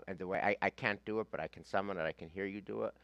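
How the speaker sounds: ordinary talk, nothing marked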